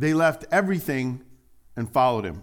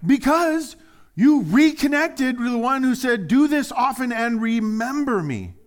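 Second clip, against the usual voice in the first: loud, high, slow preaching peak; fervent preaching; 11 semitones higher, 8 dB louder than usual. Acted. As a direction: lift the room with it. Sermon climax at full force.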